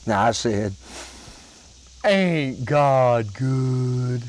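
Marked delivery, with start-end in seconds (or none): 2.0-4.2 slow, hushed thankful prayer; drawn-out reverent aside to God; little prayer. Retold or imitated. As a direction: murmur it like a grateful prayer. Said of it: "I said a little prayer".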